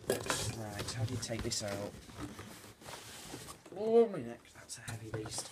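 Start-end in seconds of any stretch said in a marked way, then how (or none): none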